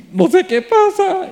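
high, slow, puzzled "no idea" voice; bewildered translator. Retold or imitated. Translator telling the preacher she has no idea, high and puzzled. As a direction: imitate her helpless shrug in words. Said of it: "Ella me hace así como".

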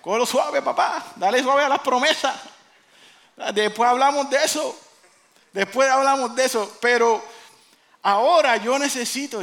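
high, loud, hammed-up reining in; comic interrupting. God telling Jack to ease off promises, loudly interrupting. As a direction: cut off the promises emphatically.

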